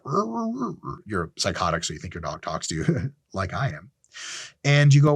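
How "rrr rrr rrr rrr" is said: high whiny dog noises, trailing off softer; dog whimper. Retold or imitated. Speaker voices his dog answering his tears with high whimpers. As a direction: whimper like a sad puppy. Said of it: "And your dog's like".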